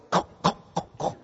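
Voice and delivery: soft clicking tongue sounds like heels; high-heel clicks. Retold or imitated. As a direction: click out her footsteps quietly. Mimicking the girl's high heels.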